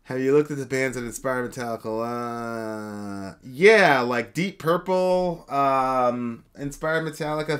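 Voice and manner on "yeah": ordinary talk, nothing marked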